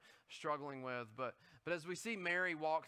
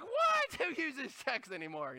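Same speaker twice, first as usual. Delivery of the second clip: mock outrage; 7 semitones higher, 3 dB louder, slower than usual. Exasperated shopper quote.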